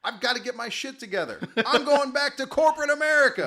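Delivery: high, very loud, laughing vow; excited declaration. Acted. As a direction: shout it with new resolve. Hobo resolving, laughing.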